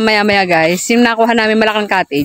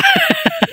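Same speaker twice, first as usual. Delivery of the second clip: high laugh. Real, not acted. Toy figure nearly falls.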